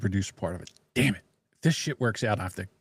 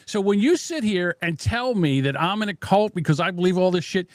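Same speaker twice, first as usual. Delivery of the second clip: raised, pitched-up angry rant at the host; heated rant; 6 semitones higher, 3 dB louder than usual. Real, not acted. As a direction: tear into her, loud and high. Ranting back at the host.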